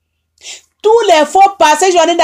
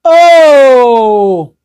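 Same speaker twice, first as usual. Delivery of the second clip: anguished loud oh; 3 semitones lower, 4 dB louder than usual. Real, not acted. Anguished outcry over killings.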